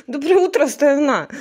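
bright announcer wake-up call; radio host greeting. Pretend. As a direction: greet the nation like morning radio. Mock radio greeting to wake son.

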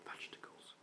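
breathless hushed word; whisper. Real, not acted. Player whispering so the babies stay asleep.

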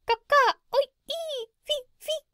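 very high piercing parrot squawks; bird language. Acted. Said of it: "But hear this".

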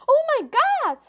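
high, loud horrified shriek. A narrator's reading. Girl shrieking at the sight of a face, high shriek. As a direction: shriek it out in shock. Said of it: "she shrieked".

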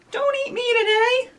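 high pleading little voice; squeaky scared dinosaur. Acted. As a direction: beg not to be eaten.

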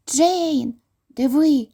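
high, eager call to his sister; excited cry. A narrator's reading. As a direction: point it out, thrilled. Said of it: "скрикнув Майкл". (Майкл гукає сестрі, схвильовано)

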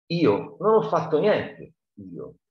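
high, slow, protesting voice trailing off; pleading denial. Acted. Muff Potter protesting his innocence, high and slow.

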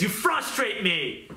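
high, slow, exasperated complaint; mock frustration. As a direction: drag it out in thwarted exasperation. Acting the thwarted devil's exasperation.

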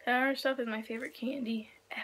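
hushed whispered aside. Real, not acted. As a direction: whisper it to the camera. Aside about candy.

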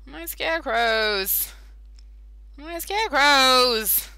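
high, slow lament; mock mourning. Real, not acted. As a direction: mourn the scarecrows. Mourning lost scarecrows.

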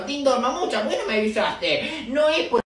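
ordinary talk, nothing marked

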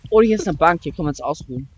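ordinary talk

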